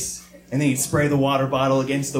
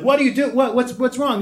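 worried mom; 9 semitones higher than usual. Retold or imitated. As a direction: play the mom rushing in, pitched up. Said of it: "My mom would run, like".

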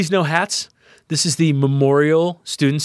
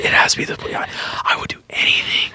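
fast, whispered begging; whispered plea to dad, fast and urgent; pleading whisper; faster than usual. Retold or imitated. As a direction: beg dad in a hushed rush. Begging dad to visit Texas.

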